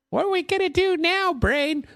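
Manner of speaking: very high, silly cartoon mouse squeak; Pinky voice. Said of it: "Pinky in the Brain".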